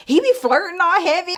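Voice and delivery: high, loud gossipy exclaim; excited gossip. Retold or imitated. Telling her coworker about Armando, excited gossip. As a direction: spill the tea eagerly.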